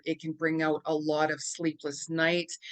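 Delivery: ordinary delivery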